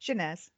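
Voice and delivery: "Chanaz" with the final Z sounded, falling; correct local pronunciation. Shown as a demonstration. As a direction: model "Chanaz" plainly, voicing the end Z. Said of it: "you do say the Z in this one".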